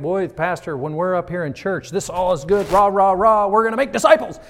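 rising to a loud rah-rah shout; mock cheerleading. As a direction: pump it up like a pep rally. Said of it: "If you feel like this".

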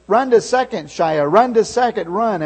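loud high urging shouts; crowd yelling. Retold or imitated. Everybody yelling for Shia to reach second, loud. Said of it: "everybody started yelling".

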